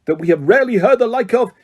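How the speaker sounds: father drowning out his son. A narrator's reading. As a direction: drown out the boy. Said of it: "speaking more loudly".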